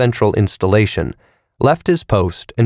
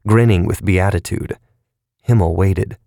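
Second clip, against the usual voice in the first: ordinary delivery, nothing marked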